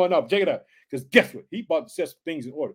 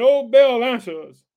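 loud, higher cry that then drops; shouting; 6 semitones higher, 8 dB louder, slower than usual. A narrator's reading. Baal's followers calling on their god, shouting. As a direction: call out to an idol.

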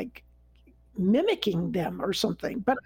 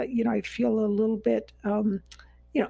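normal talking voice

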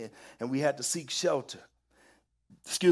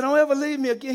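high, loud mock-desperate plea; shaken plea; 9 semitones higher, 7 dB louder, much faster than usual. Shaken troop begs returning sergeant, loud mock-rattled plea. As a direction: imitate a rattled soldier begging loudly.

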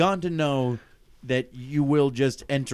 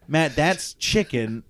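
slow exasperated; slower than usual. Retold or imitated. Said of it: "And I went".

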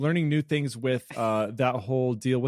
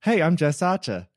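put-on higher-pitched, amateur Australian accent; bad Australian accent; 6 semitones higher, 4 dB louder than usual. American re-enacting his embarrassing practice run, bad Australian accent. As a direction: imitate an Australian introducing herself, badly. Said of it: "I have a really bad Australian accent".

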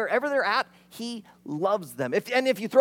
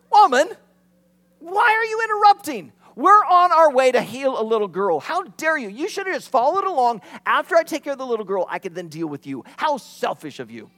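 high, loud mock-indignant scolding; hammed-up indignant scold; annoyed rebuke; 5 semitones higher, 7 dB louder than usual. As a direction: play it as an irritated, put-out Jesus. Pastor voices an annoyed Jesus.

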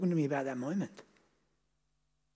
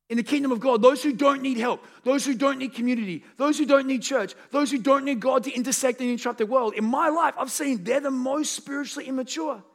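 loud, high rising preacher's build; fired-up sermon peak; preaching at full force; 8 semitones higher, 6 dB louder than usual. Acted. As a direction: drive it home from the pulpit.

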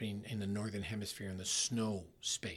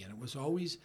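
ordinary delivery

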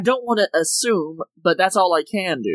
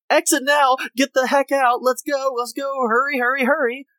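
higher, rapid-fire evacuation orders; mock urgency; 7 semitones higher than usual. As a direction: hustle everyone out in a pretend panic. Acts out a plain urgent evacuation.

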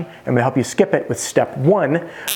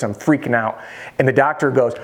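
usual speaking voice

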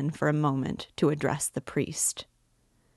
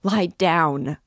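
louder, desperate shout; crying out; 8 dB louder than usual. A narrator's reading. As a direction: yell it, near panic. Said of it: "cried Katerina Ivanovna".

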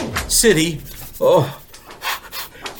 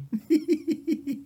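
high-pitched giggle; 12 semitones higher, 9 dB quieter than usual. Acted. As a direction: giggle at the joke. Laughing at own joke.

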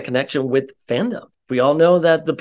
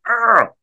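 frustrated groan; 8 semitones higher, 3 dB louder than usual. Retold or imitated. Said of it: "And you're like".

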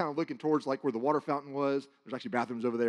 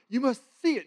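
higher, raised exaggerated call; 10 semitones higher, 5 dB louder than usual. Shown as a demonstration. Speaker showing the congregation the dream voice, exaggerated. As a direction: lift it up to give the feel. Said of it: "a little bit exaggerated ... give you the feel".

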